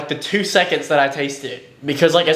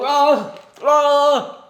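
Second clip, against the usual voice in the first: pained cry; 10 semitones higher than usual. Real, not acted. Sour candy burning tongue.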